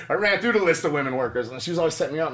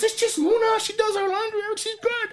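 much higher, breezy and dismissive; high mock reassurance. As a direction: brush it off in a high voice. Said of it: "He goes".

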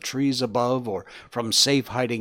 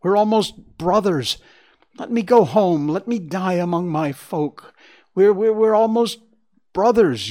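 high, loud lament; anguished, stammering entreaty; begging harder; 8 semitones higher, 7 dB louder than usual. A narrator's reading. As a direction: plead desperately for your life. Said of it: "begged him even harder".

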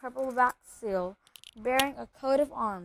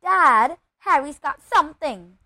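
loud, higher tattling shout; raised, insistent outburst; Dudley's sudden cry; 4 semitones higher, 12 dB louder than usual. A narrator's reading. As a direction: call out urgently to tell on someone. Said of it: "said Dudley suddenly".

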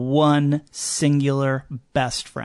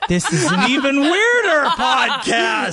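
laughing; 9 semitones higher than usual. Real, not acted. Host cracking up at the odd dog talk, laughing. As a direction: crack up while exclaiming.

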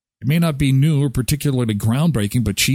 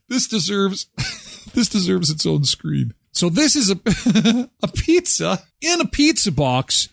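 higher-pitched, breaking into laughter mid-sentence; laughing; 6 semitones higher than usual. Real, not acted. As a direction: try to talk through real giggles. Cracking up at pizza box pizza.

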